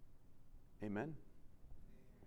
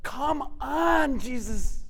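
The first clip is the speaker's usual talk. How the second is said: impatient plea; 8 semitones higher, 8 dB louder, much slower than usual. Retold or imitated. Impatient father urging Jesus.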